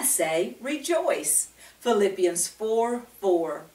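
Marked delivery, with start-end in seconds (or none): none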